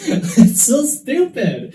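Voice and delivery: high, slow laughing squeal. Real, not acted. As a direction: laugh through it, embarrassed. Breaks into embarrassed laughter.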